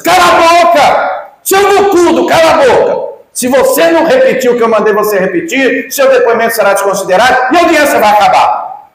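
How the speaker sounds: high, loud, screaming imitation of the judge; furious shout. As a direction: scream him down like an enraged judge.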